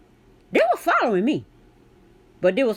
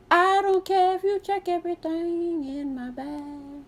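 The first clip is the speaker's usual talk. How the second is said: high, sing-song fake-cheerful voice; sugary mock-polite singsong to the store clerk; acting crazy; 10 semitones higher than usual. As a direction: chirp it sweetly with mock cheer. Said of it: "I'm acting crazy".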